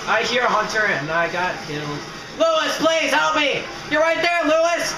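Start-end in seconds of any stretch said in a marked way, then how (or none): 2.4-4.9 desperate shout. Real, not acted. Player begging Lewis for help, desperate shout. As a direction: shout pleadingly to a teammate.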